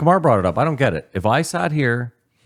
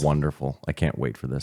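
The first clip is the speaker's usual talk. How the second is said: low, softer deadpan retort; dry sarcasm; 6 semitones lower, 7 dB quieter than usual. Retold or imitated. Host retelling a reply to a woman's criticism, dryly sarcastic. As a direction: feign delight in a low, dry voice.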